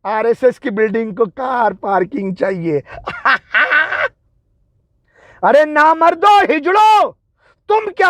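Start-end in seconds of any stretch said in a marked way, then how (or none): 3.1-4.1 mocking burst of laughter; scornful laugh. Real, not acted. Scoffing at the car parking excuse, scornful laugh.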